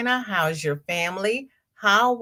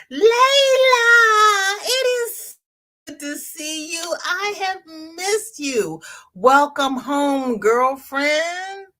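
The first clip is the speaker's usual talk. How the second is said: high, loud, squealing welcome; greeting returning Layla with an excited squeal; delighted squeal; 10 semitones higher, 4 dB louder, slower than usual. Real, not acted.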